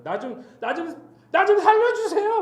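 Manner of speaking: acted begging, rising to a high loud cry; desperate plea. Job begs God to save his life, acted desperate plea. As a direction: plead as if for your life, voice climbing to a shout.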